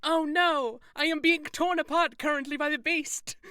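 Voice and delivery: high, loud hammed-up death announcement; over-the-top dying cry, played for laughs; mock dying shout. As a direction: proclaim your own murder in mock agony, breaking into laughter. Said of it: "make sure to just shout".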